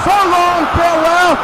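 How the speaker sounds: home run call. Pretend. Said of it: "the home run call".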